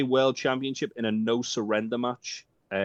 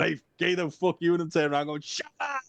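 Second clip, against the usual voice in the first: re-enacted heckle; 8 semitones higher than usual. Wrestling fan re-enacting himself heckling Gato, high mock yell. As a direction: jeer it, pitch leaping up at the end. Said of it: "video on my phone of me going".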